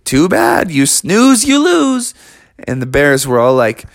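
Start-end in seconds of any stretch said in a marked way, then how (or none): 0.0-2.1 taunting mole. Acted.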